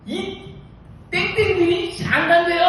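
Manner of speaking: high, loud mock outrage; fired-up indignation. Branch chair reporting colleagues who won't go, indignant. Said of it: "어우, 열받아".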